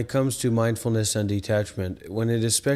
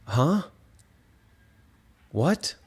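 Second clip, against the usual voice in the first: usual speaking voice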